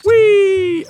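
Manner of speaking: high, loud, drawn-out squeal; loud playful whoop; gleeful whee. Real, not acted. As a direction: let out a long high "whee". Playful cry during game play.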